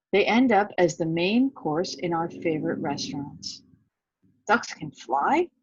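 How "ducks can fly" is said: slow, wondering small-duck voice; amazed little duck, drawn out. A narrator's reading. Little duck asks the alligator in wonder.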